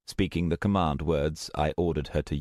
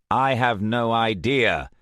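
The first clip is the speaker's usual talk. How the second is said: slow, louder exasperated reply; 4 semitones higher, 5 dB louder, slower than usual. A narrator's reading. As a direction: let the annoyance out. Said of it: "I said in exasperation".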